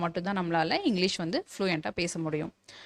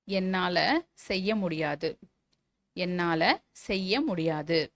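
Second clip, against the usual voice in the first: slow dictation; much slower than usual. Shown as a demonstration. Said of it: "teacher dictates a model sentence".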